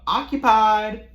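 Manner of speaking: high, slow sing-song "Occupied"; teasing bathroom-door voice; mock polite occupied call. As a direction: drag it out, high and sweet, through the door. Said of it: "I had to go".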